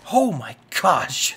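loud, drawn-out startled cry; real scare, loud and stretched; startled fright. Real, not acted. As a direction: jump out of your skin. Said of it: "that was terrifying".

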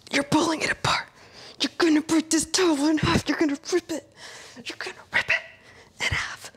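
high, strained coaching through effort; strained high push. Real, not acted. Coach urging viewers through the hold, straining. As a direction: push the words out while straining.

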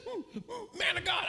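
high-pitched mock whimper into a cry; panicked pleading. Prayer leader appealing to the preacher, panicked pleading. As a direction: imitate a frightened woman appealing for help.

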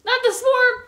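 high, loud yelp of panic; panicked yell. Real, not acted. Gamer attacked by the swarm, high panicked yell. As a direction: cry out as the swarm hits.

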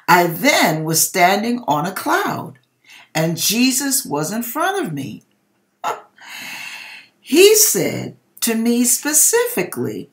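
reading a viewer's dream aloud with rising drama; story read aloud to an audience, building urgency; dramatic dream reading. Acted. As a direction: tell it like a fervent testimony.

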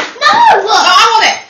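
high loud playful yell; shouting. Real, not acted. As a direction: shriek I want it. Squabble over the sweet.

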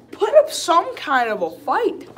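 high, loud frustrated demand; exasperated plea. Real, not acted. Fan to a struggling player, exasperated. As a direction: scold your own team from the stands. Fan scolding his own team.

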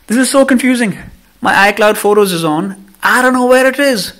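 high, loud, exasperated ranting; animated rant. Real, not acted. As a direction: vent your frustration at full pitch. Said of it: "I'm animated, I know".

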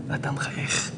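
hushed, breathy whisper. Real, not acted. Rabbi whispering the paradox to his listeners. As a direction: drop to a whisper to draw them in. Sermon lowered to a hush.

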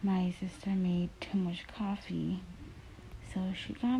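speaking under covers; muffled through blanket. Real, not acted. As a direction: fabric over mouth. Seen 0: Blanket held over mouth and nose while lying in bed throughout.

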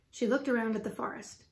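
usual speaking voice

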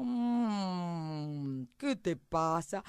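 slow, drawn-out cow voice; mooing cow. Acted. Cow interrupted at grazing asks the mouse, sluggish mooing. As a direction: begin on a long moo, then ask lazily. Cow moos her answer.

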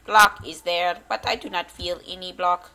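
loud, mock computer voice; Minecraft freaking out. Gamer voicing the glitched game as it freaks out, loud computer voice. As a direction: play a malfunctioning computer, loudly announcing an error. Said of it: "Minecraft is just freaking out. It's like".

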